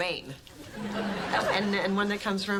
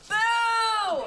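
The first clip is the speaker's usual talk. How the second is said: sudden high yell to scare; startling shout; 12 semitones higher, 6 dB louder than usual. Acted. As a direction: shout it to make him jump. Jumps out to scare husband.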